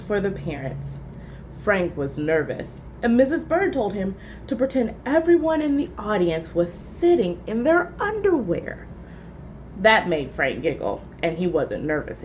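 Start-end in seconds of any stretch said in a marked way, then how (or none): none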